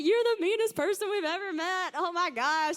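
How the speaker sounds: lightly voiced teasing girls; a hint of incredulous ribbing; mock teasing. Girls teasing their friend, mildly incredulous. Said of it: "They're like".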